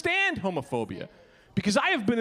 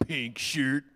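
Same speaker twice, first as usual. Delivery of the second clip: much lower, slow, deadpan stepdad voice; 4 dB quieter, slower than usual. Retold or imitated. Stepdad greets the pink shirt with low, slow disdain. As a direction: drop low and slow like a judging dad. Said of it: "My stepdad walks right up to me and just goes".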